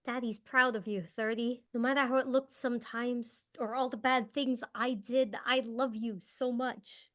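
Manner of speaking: tender goodbye. Acted. Father saying goodbye to his daughter before charging out, tender and hushed. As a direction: breathe it out softly, holding back tears.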